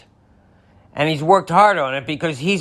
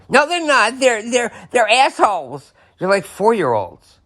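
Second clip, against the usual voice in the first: much higher mock outburst; mock angry opponent; 8 semitones higher than usual. Mocking a Republican denying the Democrats' efforts, high. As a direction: blurt it out indignantly. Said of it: "mock opposing voice".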